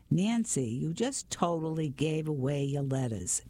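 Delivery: plain reading voice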